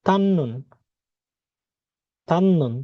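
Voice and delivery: তান্নুন said slowly with a doubled n, tan-nun; teacher models তান্নুন with the long nn; Korean word pronounced. Shown as a demonstration. Teacher models the Korean word for the class, tan-nun. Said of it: "এটার উচ্চারণ তাহলে কি হবে?".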